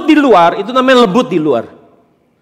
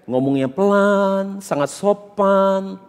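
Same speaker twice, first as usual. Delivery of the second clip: very slow, softened voice; soft, drawn-out demonstration of meekness; gentle and polite; 7 dB quieter, much slower than usual. Shown as a demonstration. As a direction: model courteous speech with long final syllables. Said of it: "itu lemah lembut".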